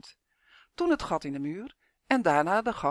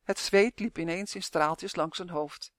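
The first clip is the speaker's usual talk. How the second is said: plain reading voice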